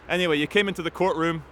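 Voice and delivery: normal talking voice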